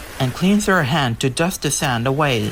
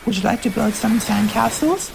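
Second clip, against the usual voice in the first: high, gentle invitation; friendly sister asking; 8 semitones higher than usual. Acted. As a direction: ask kindly if she wants to play. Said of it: "Arcee asked Elita One".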